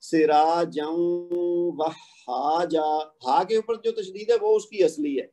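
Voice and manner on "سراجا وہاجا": سراجا وہاجا, tanween merged with a doubled waw; ادغام کے ساتھ; slow tajweed recitation. Shown as a demonstration. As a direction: draw out وہاجا very slowly. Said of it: "جب ادغام کریں گے".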